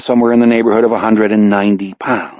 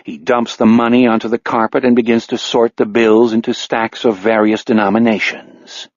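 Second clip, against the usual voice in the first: plain reading voice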